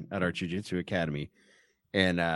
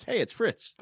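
put-on high little toddler voice; Fritz; 11 semitones higher, slower than usual. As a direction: imitate a small child announcing himself. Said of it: "his toddler son Fritz comes down the steps".

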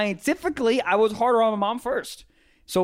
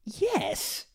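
high, soft drawn-out "yes"; impression of a rapper's catchphrase; 8 semitones higher, 7 dB quieter than usual. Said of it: "listen to my [name] impression".

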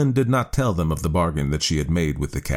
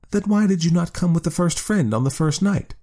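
much higher, bright proud wife; high woman's voice, pleased; 11 semitones higher than usual. A narrator's reading. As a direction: raise the pitch high as the woman. Woman character voice.

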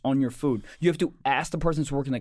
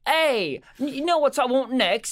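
high-pitched, entitled command from a throne; mock imperious queen; 10 semitones higher than usual. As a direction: imitate a demanding ruler summoning servants. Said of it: "sitting in, like, her throne chair".

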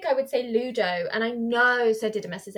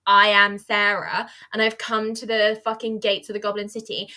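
normal talking voice

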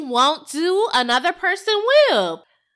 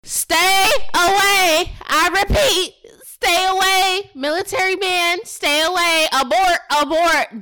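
mock warning rant; 5 semitones higher, 4 dB louder than usual. Friend warning host off the military man, mock alarm. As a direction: bark it like a warning siren. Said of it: "That's my rant".

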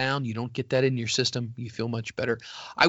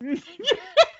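high laugh. Real, not acted. Laughing at doily joke.

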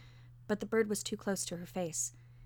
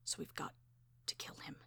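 very soft, whispery and conspiratorial; secretive, barely voiced murmur; dropped voice; 13 dB quieter than usual. A narrator's reading. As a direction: drop to a hushed near-whisper. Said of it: "she dropped her voice".

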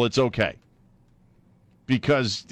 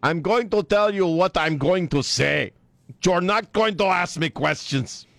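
slow, higher-pitched mock Spanish accent; thick fake Spanish accent; 7 semitones higher than usual. Host parodies her warning to reporters, thick Spanish accent. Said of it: "thick Spanish accent".

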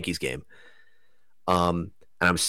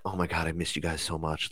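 soft, much quieter affection; tender; 2 semitones lower, 10 dB quieter than usual. Retold or imitated. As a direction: say it softly, moved. Tender reunion with the beings.

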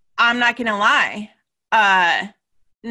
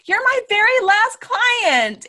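high, slow and a bit louder, announcing big news; 12 semitones higher, 4 dB louder than usual. Retold or imitated. Telling her client she's done, in a high, slow announcement. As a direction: lift the line up high and take it slow. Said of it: "I was like".

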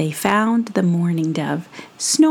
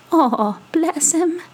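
higher, slow fond coo; mourning dove cooing fondly at the sleeping mouse; tender; 8 semitones higher, slower than usual. Acted. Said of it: "said the mourning dove".

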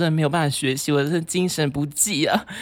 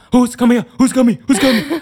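loud, higher repeated demand, imitating the roommate; mock furious roommate, louder and higher; angry demanding; 11 semitones higher, 9 dB louder than usual. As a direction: press him for an answer, louder each time. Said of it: "他就很生气".

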